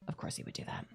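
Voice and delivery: whispered aside. Real, not acted. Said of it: "unvoiced whisper aside".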